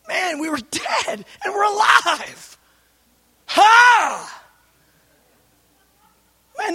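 high, crying shout of rapture; overcome, cry it out to the congregation; tearful cry of joy. Real, not acted. Overwhelmed preacher crying out.

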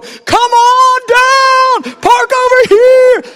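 very high, full-out parking-lot holler; fervent calling out. Retold or imitated. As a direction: yell cars in with wild zeal, drawn out. Waving cars in fervently.

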